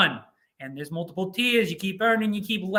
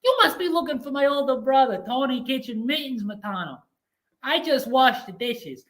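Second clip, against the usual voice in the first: high, put-on Brooklyn little brother; 7 semitones higher than usual. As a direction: play the younger brother pointing to his older brother, high. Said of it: "he probably like".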